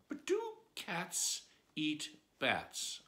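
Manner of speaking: slow, drawn-out musing; slow wondering. Acted. Alice puzzling over cats and bats, slow. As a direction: stretch each word while puzzling.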